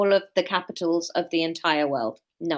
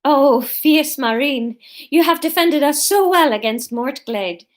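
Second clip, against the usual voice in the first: loud, higher, drawn-out mock praise; laughing mockery; 5 semitones higher, 7 dB louder than usual. A narrator's reading. Ezria teasing Marine after the fight, mock-heroic praise. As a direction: tease with grand, exaggerated admiration. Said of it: "Ezria laughed".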